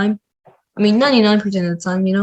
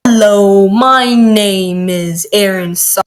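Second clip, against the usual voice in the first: loud, slow, hammed-up intro; exaggerated voice; 6 dB louder, slower than usual. Shown as a demonstration. Kid recording a Scratch sound, exaggerated loud voice. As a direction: belt out the intro like a showman. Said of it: "I kinda said it in an exaggerated voice".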